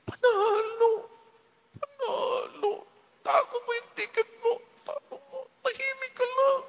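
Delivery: very high, squeaky, held-in squeal; stifled joy. Pretend. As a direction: squeal with joy while trying to keep it down. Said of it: "gusto kong sumigaw".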